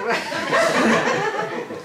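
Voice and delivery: airy high-pitched giggle; a sudden high giggle; high breathy giggle. Acted. Pen's laugh acted out.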